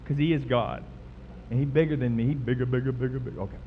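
ordinary talk, nothing marked

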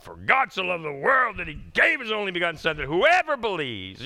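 high, loud, forceful recital of the verse; scripture as a weapon. Shown as a demonstration. Pastor demonstrating jabbing the enemy with scripture, loud forceful recital. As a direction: fire the verse out like a punch. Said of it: "Jab 'em".

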